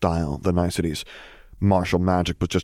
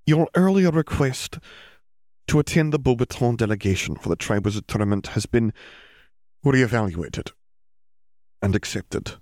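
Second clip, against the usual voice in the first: forced out reluctantly; 5 semitones higher than usual. Acted. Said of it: "as if it took great effort to force the words out".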